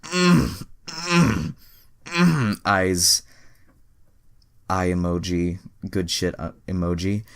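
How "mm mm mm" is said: high, loud mock moans; hammed-up moaning. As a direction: moan it up in an exaggerated way. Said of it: "started to mutter".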